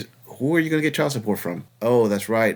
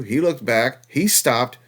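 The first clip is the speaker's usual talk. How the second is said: ordinary talk, nothing marked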